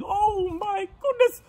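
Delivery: very high, loud piggy squeal; overjoyed pig. Acted. Pig admires himself in the borrowed mane, squealing happily. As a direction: squeal with delight at your new look. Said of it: "he was so happy".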